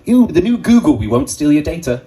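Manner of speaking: mock advert voice. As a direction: parody a glossy tech commercial. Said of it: "and it was like".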